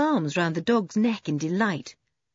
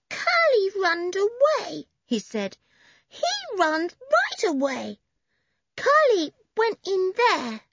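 put-on high, slow toddler voice; little boy voice; 13 semitones higher than usual. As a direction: speak like a small child. Benny's child voice.

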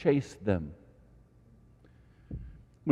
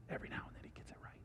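fast whispered aside; quick unvoiced aside; 25 dB quieter, much faster than usual. Real, not acted. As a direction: whisper it like a joke to the crowd.

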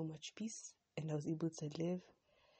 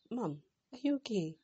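a raised, startled check on mom; higher and louder, caught off guard; startled question; 6 semitones higher, 6 dB louder than usual. Retold or imitated. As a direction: ask it in sudden surprise. Shocked to find mom sitting.

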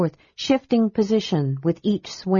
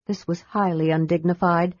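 usual speaking voice

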